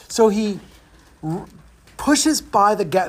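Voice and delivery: usual speaking voice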